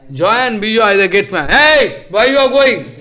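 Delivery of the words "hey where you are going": loud and high, a guard halting intruders; gateman's shout. Retold or imitated. Gatemen stopping sages at the gate, loud high challenge.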